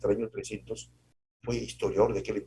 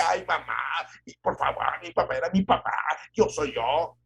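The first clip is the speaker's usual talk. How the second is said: exasperated son; 6 semitones higher, 3 dB louder than usual. Retold or imitated. Son answering mother, exasperated.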